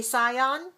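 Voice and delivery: scion read as sigh-on; spelling pronunciation. Shown as a demonstration. Teacher questioning how SION sounds, spelling pronunciation. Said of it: "Do we ever say scion?".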